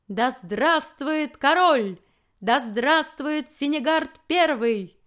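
slow, loud repeated proclamation; town crier. A narrator's reading. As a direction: cry it out again and again like a herald. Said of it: "а затем снова и снова". (клич глашатая снова и снова)

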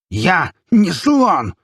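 very slow, high, loud outburst; enraged shout, word by word; trembling with anger. A narrator's reading. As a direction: force out each word in shaking fury. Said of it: "дрожащим от гнева голосом".

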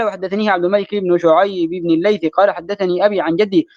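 normal talking voice

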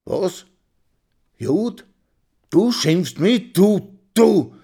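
high, loud indignant challenge; outraged. Acted. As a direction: throw the insult back. Insulted man confronts Karl.

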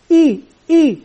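"إي" as a long, clear ee, said twice; sound out the kasra. Shown as a demonstration. Teacher models the letter for children, sounding out "إي" as long ee. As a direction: give "إي" as two separate, held ee sounds. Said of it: "من ينطق هذا".

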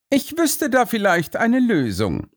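very high, smug detective voice; self-satisfied sleuth, pitched far up; self-assured Holmes. A narrator's reading. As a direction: offer a solution in a lofty high voice. Holmes voiced as a story figure.

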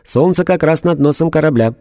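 a robot reporting dispassionately; emotionless robot. A narrator's reading. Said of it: "доложил робот".

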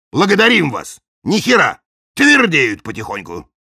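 a hint of swaggering nobles; cheeky don voice. Two dons answer.

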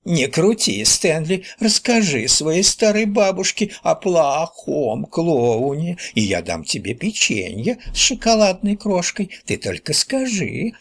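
put-on high falsetto old granny, drawn out; grumbling granny. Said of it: "бабки-ворчуньи". (голос бабки-ворчуньи, фальцетом)

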